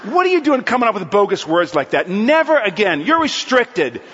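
loud, hammed-up telling-off; mock angry scolding. Group leader banning someone's words, loud mock outrage. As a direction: lay down the law, comically overdone.

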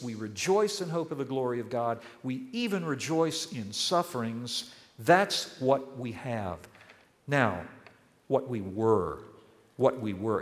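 ordinary talk

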